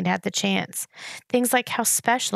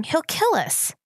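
higher-pitched anxious plea; almost pleading; 8 semitones higher, slower than usual. A narrator's reading. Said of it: "almost pleading".

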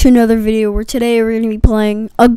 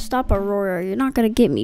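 ordinary talk

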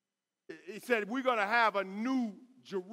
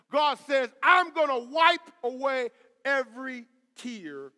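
loud, raised-pitch pulpit proclamation; full-force preaching; 4 semitones higher, 8 dB louder than usual. Real, not acted. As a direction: drive each phrase home to the congregation.

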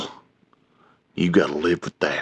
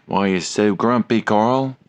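ordinary delivery

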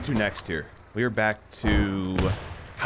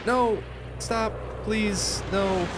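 panicked pleading; 13 semitones higher, much slower than usual. Real, not acted. Pleading as he gets hit.

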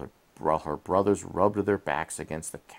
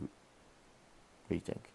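soft whispered aside; 17 dB quieter than usual. Real, not acted. Aside to child while reading.

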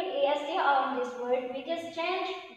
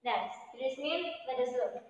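normal talking voice

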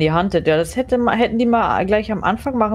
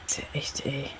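hushed; 3 semitones lower, 15 dB quieter than usual. Real, not acted. Scared during ghost hunt.

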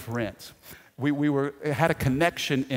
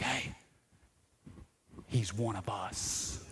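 quiet aside; 7 semitones lower, 12 dB quieter, slower than usual. Retold or imitated. Quiet aside spotting bracelet.

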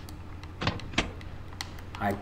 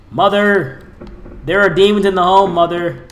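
hammed-up louder alarm to mother; dramatic call; 5 semitones higher, 8 dB louder than usual. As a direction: warn mother like in a melodrama.

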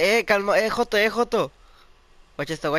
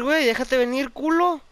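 1 taunting call; 9 semitones higher, 4 dB louder than usual. Real, not acted. Taunting an enemy.

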